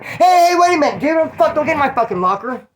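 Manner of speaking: high, loud yelled protest; imitated angry yell. Cellmate protesting at his raided locker, imitated yelling. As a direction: mimic an outraged yell, easing at the end. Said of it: "so he did yell at me".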